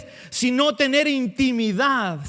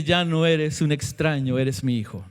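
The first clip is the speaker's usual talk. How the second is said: tender voice of Jesus; 7 semitones lower than usual. Acted. Jesus speaking tenderly to believer.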